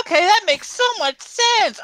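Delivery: sarcastic. Pretend. Mock follower, sarcastic.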